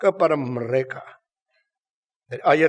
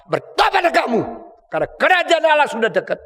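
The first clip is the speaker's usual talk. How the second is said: high, forceful call to repent; acted prophet's proclamation, pitched high; preaching cry; 8 semitones higher, 4 dB louder than usual. Acts John the Baptist preaching repentance.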